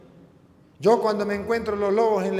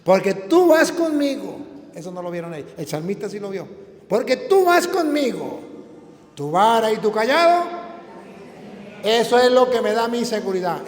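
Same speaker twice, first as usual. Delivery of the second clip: proclaimed psalm; 4 semitones higher, 8 dB louder than usual. Acted.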